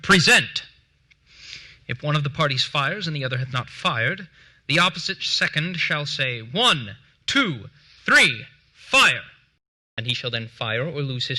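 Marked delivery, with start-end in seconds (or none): 0.0-0.6 firm, carrying call of "Present."; loud, distinct command. Shown as a demonstration. Duel second calling the parties to present, loud and distinct. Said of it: "shall loudly and distinctly give the word". 6.4-9.2 building countdown. Shown as a demonstration. Duel second counting down to the shot, building to loud.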